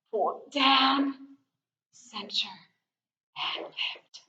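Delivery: soft whisper, fading out. Real, not acted. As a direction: breathe the cues. Whispered stretch cues.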